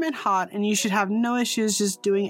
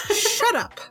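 high exasperated shout; 11 semitones higher than usual. Retold or imitated. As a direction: shut up pitched way up. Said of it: "I'm like".

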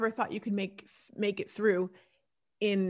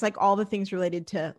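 ordinary talk, nothing marked

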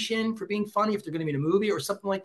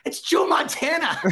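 laughing punchline; 11 semitones higher, 4 dB louder, slower than usual. Real, not acted. Punchline of his own story.

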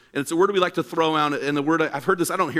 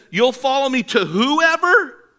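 higher, louder, slow disbelieving question; incredulous challenge; 10 semitones higher, 6 dB louder, slower than usual. Retold or imitated. Jesus challenging the would-be follower, incredulous and slow. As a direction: stretch it out, daring them to answer.

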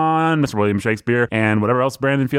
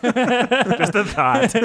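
laughing; 8 semitones higher, much slower than usual. Real, not acted. Laughing echo, slow and high.

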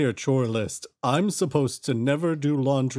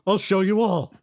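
higher defiant outburst; defiant rising; 6 semitones higher, 3 dB louder than usual. Acted. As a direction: build the threat louder. Defiant character rant escalates.

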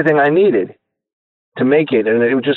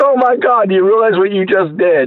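high, amazed retelling of the friends' reaction; amazed friends; 7 semitones higher than usual. Retold or imitated. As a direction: gush like they can't believe it. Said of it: "They're like".

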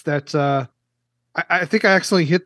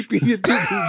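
laughing; 5 semitones higher than usual. Real, not acted. Laughing at the joke.

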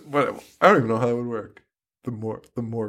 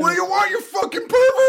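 very high, shrieked insult; mock shriek. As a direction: scream at the stranger like a furious teen. Mocking the girls confronting Myers.

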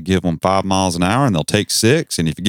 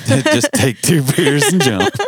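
higher, slower, laughing through the words; 9 semitones higher, slower than usual. Real, not acted. Co-host repeats the joke to the other host, laughing. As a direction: crack up while saying it.

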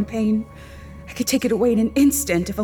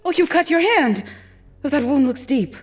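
higher, alarmed concern; concerned gasp; 9 semitones higher than usual. Acted. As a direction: react with sudden worry. Wife sees his wound.

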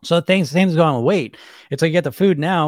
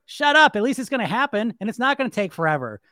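acted firm rebuttal; 8 semitones higher than usual. Dieter answering the bully voice, emphatic comeback. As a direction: shut the nag down, then ease into reasoning.

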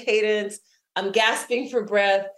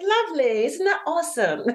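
higher-pitched imitated English accent; Cambridge English accent; 9 semitones higher than usual. Cambridge woman admiring heart-shaped pebble, imitated English accent. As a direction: lightly put on a delighted Cambridge English voice. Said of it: "she's from Cambridge".

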